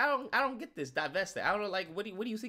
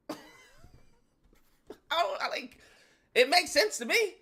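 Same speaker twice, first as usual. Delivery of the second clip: ordinary talk, nothing marked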